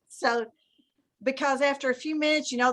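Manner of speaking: usual speaking voice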